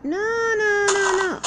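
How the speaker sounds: high, loud, near-crying protest; panicked no. Real, not acted. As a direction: cry out in alarm, voice cracking high. Dreading something in the game.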